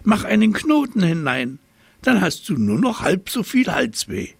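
high, eager monkey voice; monkey giving the giraffe tricky advice, high and cheeky; chattering monkey. A narrator's reading. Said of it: "schnatterte der Affe".

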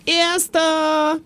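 high, drawn-out triumphant call; boyish yell. A narrator's reading. Little knight bragging he is first, yelled. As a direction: crow it out high and long. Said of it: "brüllte Jonathan".

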